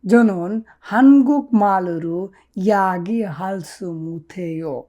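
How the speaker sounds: "জনন নেইল হুইসায়ে আনুল কোয়ো" drawn out very slowly; slow Korean model. Shown as a demonstration. Teacher models the Korean answer very slowly. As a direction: dictate the Korean line word by word. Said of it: "আপনি বলুন যে".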